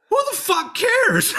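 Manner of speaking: high-pitched, slow scoffing retort; dismissive scoff. Retold or imitated. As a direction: sneer it off in a squeaky mock voice. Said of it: "And I'm just like".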